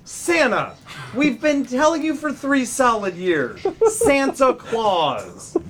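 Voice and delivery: mock-exasperated parent, high and loudening. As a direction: spell it out to the kid, dragging the last words. Acted exasperated parent answering child.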